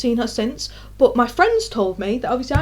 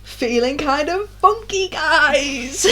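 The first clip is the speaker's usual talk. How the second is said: slow, airy, higher, laughing at the end; mock woozy casual; 4 semitones higher, much slower than usual. Standing up in a lecture before fainting, slow breezy. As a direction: play it off like nothing's wrong. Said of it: "and I was like".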